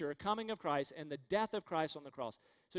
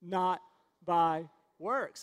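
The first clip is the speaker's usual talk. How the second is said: louder, word by word, punchy; emphatic recitation; 5 dB louder, much slower than usual. Shown as a demonstration. Preacher making the congregation repeat it, firm and loud. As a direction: drive each word home for the crowd. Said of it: "I think we need to say it again".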